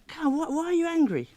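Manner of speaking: high-pitched coaxing question; coaxing a puppet. Retold or imitated. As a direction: plead playfully with a hand puppet. Therapist coaxing her hand as puppet.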